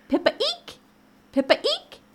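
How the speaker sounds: very high little squeal on eek; mouse squeak. Acted. Mouse arriving at the hole, high squeaky voice. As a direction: squeak it like a tiny storybook mouse.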